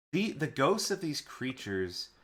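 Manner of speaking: usual speaking voice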